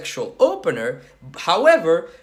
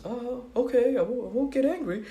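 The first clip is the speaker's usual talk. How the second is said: higher, lightly put-on girl's voice; girl agreeing; 7 semitones higher than usual. Girl promises the man she won't get angry, put-on girl voice. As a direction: play the girl giving in, pitched up. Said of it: "And the girl goes".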